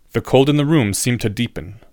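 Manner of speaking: plain reading voice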